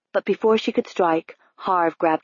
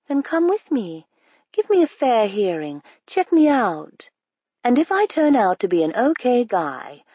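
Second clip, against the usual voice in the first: eager stranger. Acted. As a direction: lean in hard, coax her along. Stranger urging her to come.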